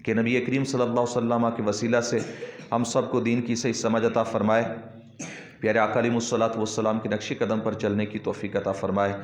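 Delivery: closing supplication. Real, not acted. Teacher prays for correct understanding of faith, low supplication. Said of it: "بارگاہ میں دعا ہے".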